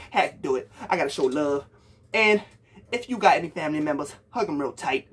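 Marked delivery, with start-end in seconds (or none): none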